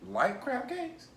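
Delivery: wait, what?; surprised disbelief. Real, not acted. Disbelief at crab cakes.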